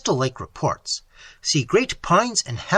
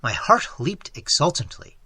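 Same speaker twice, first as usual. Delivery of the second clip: plain reading voice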